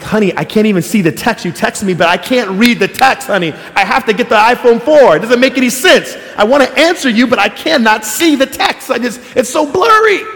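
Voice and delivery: pleading with his wife for the new iPhone, whining; whiny plea to wife. Retold or imitated. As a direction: complain to honey like a frustrated kid. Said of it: "I was like".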